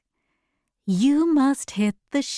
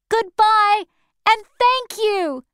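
high, raised goodbye; called farewell; 11 semitones higher, 4 dB louder than usual. Acted. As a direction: call out thanks as they leave. Dorothy calls farewell to monkeys.

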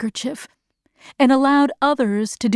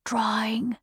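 hushed, much softer voice; whispered; 9 dB quieter than usual. A narrator's reading. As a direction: whisper it secretively. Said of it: "whispered the gray-haired man".

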